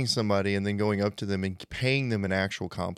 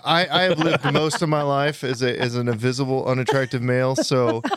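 joking; 5 semitones higher, 7 dB louder than usual. Real, not acted. Joking self-mockery, laughing.

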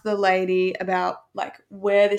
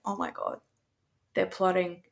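softly voiced private panic; inner thought; 6 dB quieter than usual. Retelling her thought in the lobby, hushed aside. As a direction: think it aloud, much quieter. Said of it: "in my head".

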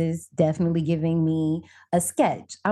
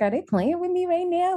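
incredulous; 11 semitones higher than usual. Retold or imitated. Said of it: "I was like".

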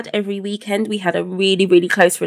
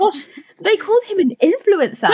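amused disbelief; 11 semitones higher than usual. Retold or imitated. Retelling her amused disbelief.

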